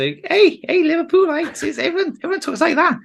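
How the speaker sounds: very high-pitched Scouse; Liverpool accent. Shown as a demonstration. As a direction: squeal it in exaggerated Scouse. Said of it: "Liverpool. So".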